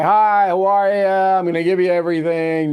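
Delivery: a light, friendly politician voice; smiling campaigner. Retold or imitated. Commentator mimics a smiling candidate greeting voters. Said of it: "Smiling guy".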